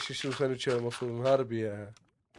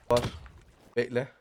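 ordinary talk

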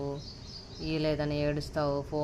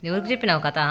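insistent repeat; 4 semitones higher, 8 dB louder, faster than usual. Real, not acted. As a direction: press for an answer, louder, ఎవరికి చెప్పినావు కథ pushed harder. Parent repeats question, more insistent.

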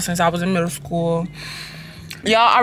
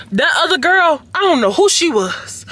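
higher, sassy brush-off; sassy dismissal; 8 semitones higher, 3 dB louder than usual. Acted. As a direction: wave off your old self with sass. Disowns her earlier bad mood.

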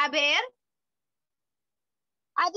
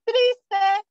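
sad; 2 semitones higher, 6 dB louder than usual. Shown as a demonstration. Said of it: "todos una carita triste".

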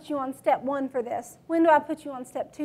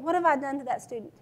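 ordinary delivery

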